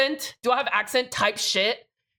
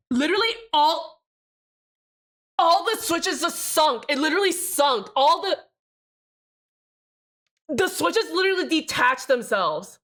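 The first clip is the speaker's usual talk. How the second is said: real disbelief; 5 semitones higher than usual. Real, not acted. Keyboard PCB collapses on stream.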